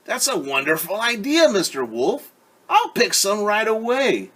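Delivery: high, loud and eager; excited little girl. Acted. Little Red thrilled by the wolf's idea, eager and high. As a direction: she can't wait to pick flowers.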